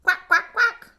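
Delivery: high, loud duck quacks climbing; a welcoming duck, quacking up high; greeting duck quacks. A narrator's reading. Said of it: "The sheep arrived fashionably late".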